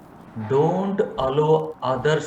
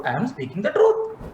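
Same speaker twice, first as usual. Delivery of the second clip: indignant mock protest; 6 semitones higher than usual. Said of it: "Patanjali Maharshi told we should speak the truth".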